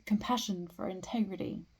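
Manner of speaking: slow, deliberate recital, wide pitch swings; poetry reading. Acted. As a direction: let each line hang before the next. Reads a poem aloud.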